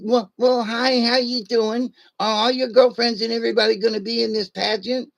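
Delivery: high nasal nerd voice; squeaky-high comic nerd, in character. Acted. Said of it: "I was in character".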